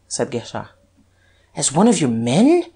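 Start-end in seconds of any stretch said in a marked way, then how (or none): 1.5-2.6 eager. A narrator's reading. Duke pressing the detective for news, eager. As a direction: lively question with a swooping rise. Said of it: "said the Duke eagerly".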